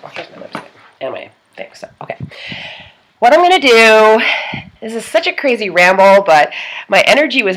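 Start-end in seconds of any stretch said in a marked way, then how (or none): 0.0-2.2 whispered aside. Real, not acted. Aside while fetching lipstick.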